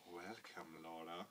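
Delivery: low, soft menacing Dark Lord; villain greeting. Acted. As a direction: purr the welcome low and quiet. Dark Lord greets captive princess.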